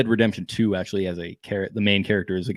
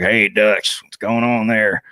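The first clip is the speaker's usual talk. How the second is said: Arthur Morgan voice. Retold or imitated. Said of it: "he talks like this".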